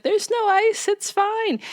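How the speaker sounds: high-pitched acted dismissal; airy brush-off. Lorelai insists to Max that all is fine, high dismissive voice.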